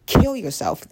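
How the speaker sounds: exaggerated mock comeback; mock snap. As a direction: bite back at the critic, hammed up. Snapping back at imagined critic.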